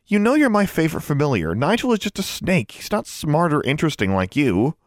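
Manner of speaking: high-pitched coaxing reassurance; pleading. A narrator's reading. Girl winning back her jealous raven, pleading. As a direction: coax and flatter a sulking pet. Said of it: "she pleaded".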